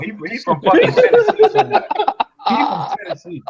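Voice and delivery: high laughing jeer. Real, not acted. Mocking the fighter's hometown, laughing high. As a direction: laugh the words out as you mock him.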